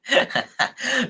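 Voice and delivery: high burst of real laughter; cracking up at a friend's joke. Real, not acted.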